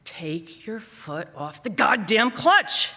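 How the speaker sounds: slow, loud, sharp staccato bark; loud staccato yell. Retold or imitated. Father barking at his daughter on the steep hill, loud staccato. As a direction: spit each word out loud and sharp. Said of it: "He spit ... loud and shocking, in sharp staccato".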